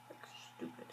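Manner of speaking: breathy whisper under her breath; whispered aside. Real, not acted. Recapping a TV episode, she whispers a put-down. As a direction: let it slip as a whispered aside. Muttered aside about the plot.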